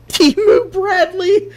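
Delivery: high-pitched laughing disbelief; slow words cracking up into laughter; squealing with laughter at the name. Real, not acted. Laughing at silly build name.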